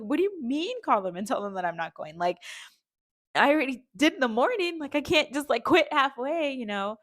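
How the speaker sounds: indignant protest. Retold or imitated. Refusing her husband's suggestion, high exasperated protest. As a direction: push back in disbelief.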